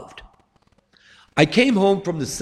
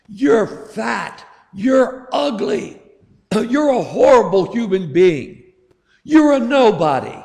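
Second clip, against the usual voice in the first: higher, louder mock tirade of insults; daughter yelling; 5 semitones higher, 3 dB louder than usual. Daughter retold yelling at her dying father, a mock tirade. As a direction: hurl the insults like a hurt daughter. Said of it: "his daughter yelling at him".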